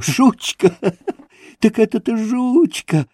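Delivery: high, overjoyed exclamations with a laugh; blissful cries. A narrator's reading. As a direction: cry out in childlike bliss. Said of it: "выкрикивал он блаженным голосом".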